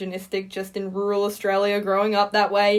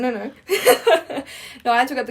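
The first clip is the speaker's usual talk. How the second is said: laughing; 4 semitones higher, 5 dB louder than usual. Real, not acted. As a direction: laugh through the denial. Laughing denial of dopamine hit.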